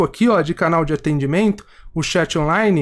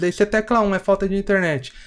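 usual speaking voice

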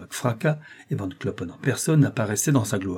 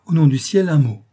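desperate plea; 6 semitones higher, 7 dB louder than usual. Acted. Client begging the couturier.

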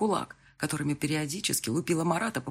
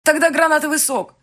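much higher and louder; raised voice; 12 semitones higher, 11 dB louder than usual. Acted. Bride loudly sending fiancé off through the door. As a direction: call him away, strained.

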